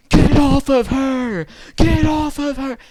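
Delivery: high, loud, drawn-out yell. Retold or imitated. Boyfriend at a brawl defending his girlfriend, yelling. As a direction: imitate a kid screaming at the top of his voice. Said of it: "he just yells".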